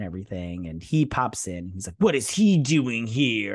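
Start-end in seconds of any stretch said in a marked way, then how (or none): 2.0-3.5 loud, drawn-out mock outrage; hammed-up angry demand, each word stretched; mock angry kid. Kid bursting in on his mom's visitor, mock angry. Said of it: "You're so angry for no reason".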